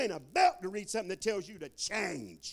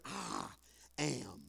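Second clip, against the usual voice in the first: very low, hushed I am; preacher intoning the holy name, low and soft; deep solemn; 14 semitones lower, 10 dB quieter than usual. Acted. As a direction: drop the voice to the floor for I am. Emphatic divine name.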